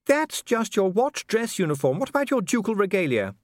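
a noble lady musing aloud; Lady Sybil. A narrator's reading.

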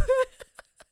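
a high, loud burst of laughter. Retold or imitated. Mate laughs at the friend.

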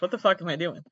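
ordinary talk, nothing marked

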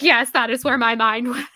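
loud, high laughing yes; laughing admission. Acted. Telling someone where the mind went, laughing admission. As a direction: own up to it loudly through a laugh. Owning up to the weird spiral.

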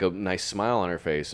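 ordinary talk

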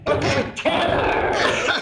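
laughing, breathy, drawn-out hiss; heavy breathing phone whisper. Acted. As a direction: breathe the words out slowly, laughing. Twin hisses at doctor.

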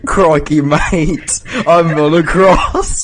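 loud, high mock "Crikey mate" voice; hammed-up Aussie slang, laughing; mock Aussie Jesus. Friend mocking Jesus in a loud Aussie accent.

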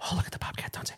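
whispered, breathy wide-eyed tourist; hushed, awestruck, a bit silly; hushed naive tourist. Retold or imitated.

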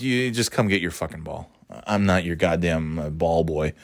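low, soft, annoyed grumble; grumbled retort. Retold or imitated. Man refusing to fetch kid's ball, grumbling.

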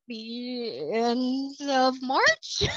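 long stretched-out end of March; drawn-out answer. Retold or imitated. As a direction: draw out each word, half laughing. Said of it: "I'm like".

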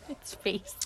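hushed whispered remark. Real, not acted. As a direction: whisper under your breath. Whispered aside.

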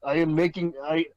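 put-on light joke Russian accent; broken Russian-accented English, deadpan. Joking as a Russian worker.

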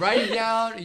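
real laugh, pushing the joke. Real, not acted. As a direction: laugh through the words. Joking after group laughter.

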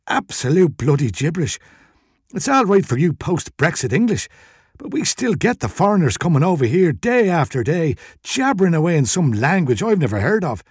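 wheezy shopkeeper complaining; burly Irish shopkeeper. A narrator's reading. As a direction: grumble as a put-upon Irish shop owner.

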